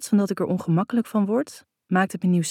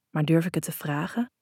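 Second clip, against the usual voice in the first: ordinary delivery, nothing marked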